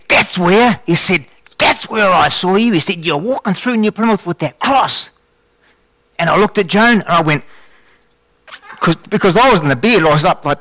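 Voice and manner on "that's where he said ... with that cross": high, eager voice of discovery; a young man suddenly recognizing him; excited recognition. Retold or imitated. Fred in the hospital bed recognizing the cross walker, excited and high.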